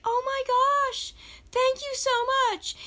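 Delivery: very high, delighted gasp of thanks; a stranger in a store, flattered and delighted by a child's compliment, squealing thanks; delighted surprise. Retold or imitated. High squeal of thanks to a child. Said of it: "and she's like".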